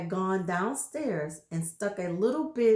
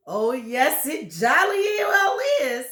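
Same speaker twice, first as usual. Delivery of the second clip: louder, higher mock insistence; gloating husband; 5 semitones higher, 7 dB louder than usual. Husband insisting to wife, gleeful and louder. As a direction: insist with glee. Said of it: "said Mister Twit".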